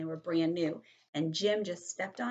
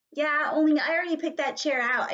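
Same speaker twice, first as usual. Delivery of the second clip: put-on high, loud child's protest; indignant, raised kid voice; insistent arguing; 8 semitones higher, 7 dB louder than usual. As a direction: argue back loudly for the chair. Child argues over a chair.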